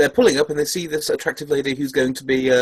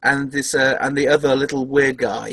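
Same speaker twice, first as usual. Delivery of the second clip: ordinary talk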